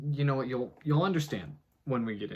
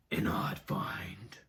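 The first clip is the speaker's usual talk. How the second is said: breathy, slow whispered title; spooky whisper; 5 semitones lower, 5 dB quieter, much slower than usual. Acted. Narrator whispering the chapter title to viewers. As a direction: hush it like a secret chapter heading.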